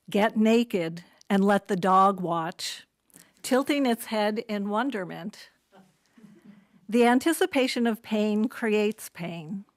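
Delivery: measured poetry recital with pauses between phrases; poem reading. Acted. Poet reads to an audience at a literary event, measured recital.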